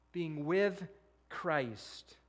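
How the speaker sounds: Being with Christ, each word slowed and weighed; preacher slowly stresses "Being with Christ"; slow emphasis. Real, not acted. Repeats the key phrase slowly.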